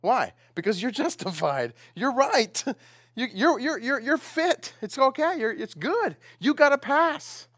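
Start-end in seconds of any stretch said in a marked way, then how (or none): 2.0-7.5 excited. Real, not acted. Excited reassurance to congregation.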